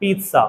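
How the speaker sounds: "पिज़्ज़ा" as "peet-saa"; correct pronunciation. Shown as a demonstration. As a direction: put a t before the s in "पिज़्ज़ा". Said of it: "सही pronunciation तो ये वाला है". ("पिज़्ज़ा" में "त्सा" की आवाज़)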